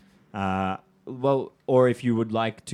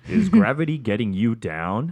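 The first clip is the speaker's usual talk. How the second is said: advertising slogan; 5 dB louder than usual. Acted.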